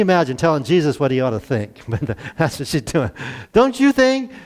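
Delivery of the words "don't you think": much higher, pointed mock whine. Preacher mimics Martha nagging Jesus, high mock whine. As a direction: nag the guest a little. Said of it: "interrupts and says".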